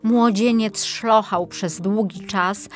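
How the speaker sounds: plain reading voice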